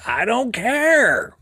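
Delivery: imitating Tommy Lee Jones, very slow and drawn out. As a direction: drag out each word like a gruff marshal. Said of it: "it's the way he said it".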